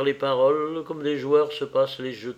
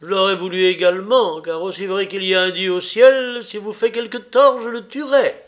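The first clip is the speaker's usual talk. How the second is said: loud, high, vehement vow; cutting threat; 6 semitones higher, 8 dB louder than usual. A narrator's reading. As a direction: hurl it like a dagger, rising in pitch. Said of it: "ces paroles qui coupaient l'air comme un poignard". (menace violente et tranchante)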